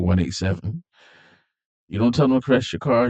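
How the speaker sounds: ordinary talk, nothing marked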